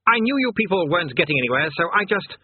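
high-pitched, self-justifying chatter; flustered demon king. A narrator's reading. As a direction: explain yourself until cut off. Flustered king explains himself.

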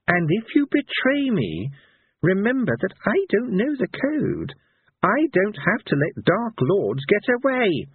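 high, slow, emphatic warning; threatening minstrel. A narrator's reading. Minstrel warning a villain against betrayal, stern. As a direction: measure out each word of the threat.

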